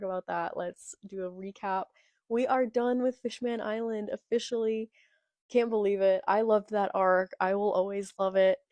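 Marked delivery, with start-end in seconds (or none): none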